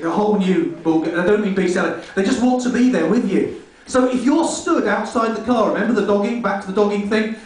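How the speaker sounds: ordinary delivery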